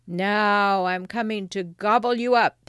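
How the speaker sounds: loud, threatening troll voice; roaring troll. Acted. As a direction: threaten to devour them as a troll. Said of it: "roared the troll".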